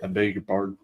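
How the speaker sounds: put-on understated Southern-accented politeness. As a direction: answer with a mild Southern accent. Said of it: "developed a Southern accent".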